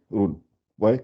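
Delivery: ordinary delivery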